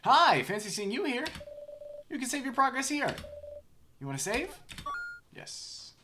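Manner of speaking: very high, cheerful helper voice; chirpy high voice. Acted. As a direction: squeak it like a cartoon helper. Voices the save point character.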